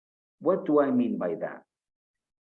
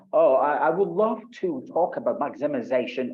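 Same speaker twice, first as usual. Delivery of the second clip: mock pompous learner; 4 semitones higher, 5 dB louder than usual. Shown as a demonstration. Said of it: "don't use big and unfamiliar words".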